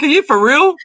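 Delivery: high incredulous question, close to laughing; retold scoffing challenge; amused disbelief. Host answering woman's serious report, amused disbelief. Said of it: "I just started laughing".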